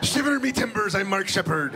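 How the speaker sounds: loud, high hammy pirate shout. Retold or imitated. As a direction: bellow it like a sea captain. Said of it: "make me sound like a pirate".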